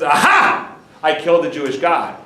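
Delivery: gloating triumph. Acted. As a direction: crow it like a conqueror who thinks he killed God. Titus gloats over bloody curtain.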